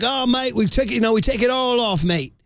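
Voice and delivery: high-pitched mock Australian accent. Host quoting an Australian stripper, high mock Australian accent. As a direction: imitate an Australian stripper, voice lifted. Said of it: "they had the, the Australian accent".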